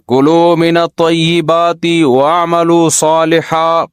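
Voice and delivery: slow, measured Arabic recitation; Quranic recitation. Acted. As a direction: recite the verse slowly and reverently. Quranic verse recited in sermon. (آہستہ قرأت)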